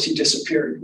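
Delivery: usual speaking voice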